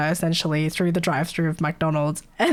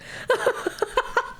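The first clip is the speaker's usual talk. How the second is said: high unvoiced cackle; laughing; 3 dB quieter than usual. Real, not acted. Streamer cackles after the crude remark about the caller. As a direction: crack up at your own joke.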